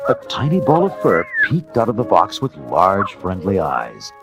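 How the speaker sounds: ordinary delivery, nothing marked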